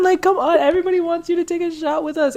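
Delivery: high, eager coaxing; playful high-pitched peer pressure; urging. Acted. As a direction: press a shy friend to come along. Urges roommate to join.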